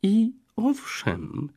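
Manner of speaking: very high, slow little voice; shy girl answering her suitor in a tiny high voice; tiny thin voice. A narrator's reading. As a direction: squeak it out shyly. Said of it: "jeszcze cieńszym głosikiem".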